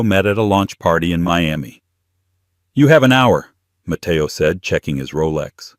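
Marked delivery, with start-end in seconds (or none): 2.7-3.5 high, loud ultimatum; curt dismissal. A narrator's reading. Man ordering his partner out of the apartment, curt. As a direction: lay down the deadline forcefully.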